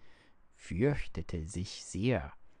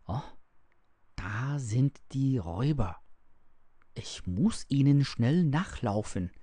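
eager, slightly higher and louder thought; eager exclamation; 4 semitones higher, 4 dB louder than usual. A narrator's reading. Little Heinrich spots the robbers and wants to follow, eagerly. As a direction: voice a child's excited realisation.

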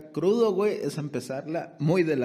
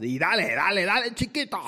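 boxing commentator; 7 semitones higher, 5 dB louder than usual. Acted.

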